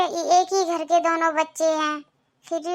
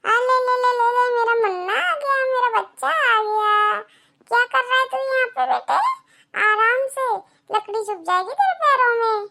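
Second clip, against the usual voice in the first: doting grandmother; 5 semitones higher than usual. Acted.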